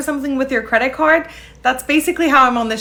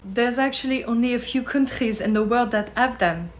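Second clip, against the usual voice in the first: put-on softer, casual French accent; 3 dB quieter than usual. Frenchwoman dismissing credit cards to tourist, French accent. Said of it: "a very impressive French accent".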